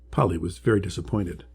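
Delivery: ordinary delivery, nothing marked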